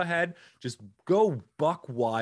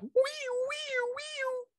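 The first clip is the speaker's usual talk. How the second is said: falsetto wees; much slower than usual. Shown as a demonstration. Host trying again to whoosh listeners in, high squeals. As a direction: squeal them high like a playground ride. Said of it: "let me try that".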